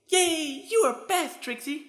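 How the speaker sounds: very high squealing yay; delighted cheer. Acted. Pinkie cheers Trixie for agreeing to a party, squealing high.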